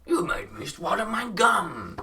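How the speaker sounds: high, slow mimicked line; quoting a character. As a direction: mimic the guy's whiny complaint. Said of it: "and he said".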